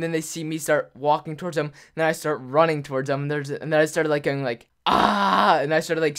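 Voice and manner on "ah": loud, high retold scream; mock charging yell; imitated scream.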